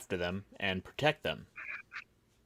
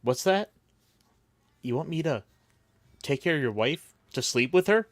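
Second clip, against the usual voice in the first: higher-pitched mock innocence; scheming best friend; 6 semitones higher, 4 dB louder than usual. Best friend twisting a dying man's last words, mock innocence. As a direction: feign surprise, then coy reluctance. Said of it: "his best friend's like".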